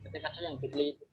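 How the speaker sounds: choppy breaking-up call audio; glitching robot. Real, not acted. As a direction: voice cutting in and out. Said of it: "Suaranya putus-putus".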